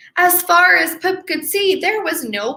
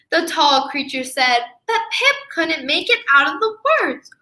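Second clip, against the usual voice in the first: ordinary delivery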